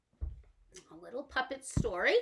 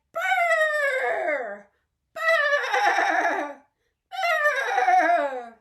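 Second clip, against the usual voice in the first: very high loud trumpeting; elephant trumpet; 12 semitones higher, 9 dB louder, much slower than usual. Pretend. Teacher trumpeting like an elephant for children. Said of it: "The elephants of the jungle say".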